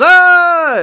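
loud, high-pitched mock scream; sudden hammed-up shriek. Lori pranking her friends from the hole, mock scream. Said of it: "She starts screaming all of a sudden".

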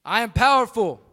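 loud, high affirmation; booming motivational speech. Real, not acted. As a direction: call it out for the crowd to repeat. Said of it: "please repeat after me".

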